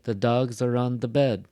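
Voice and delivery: "dogs" and "bed" strong, "are on the" light; stress on dogs and bed. Shown as a demonstration. Teacher modeling sentence rhythm, strong "dogs" and "bed". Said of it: "Notice what are the strong parts".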